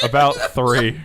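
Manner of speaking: loud answer through laughter; real laughing. Real, not acted. Co-host answering how often he has smiled, laughing. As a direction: answer while still cracking up.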